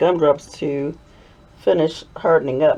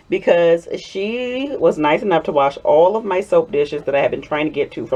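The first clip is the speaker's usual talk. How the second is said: ordinary talk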